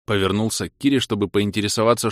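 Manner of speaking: ordinary delivery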